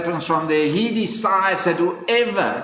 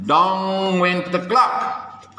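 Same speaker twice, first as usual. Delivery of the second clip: plain reading voice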